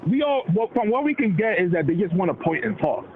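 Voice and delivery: ordinary talk, nothing marked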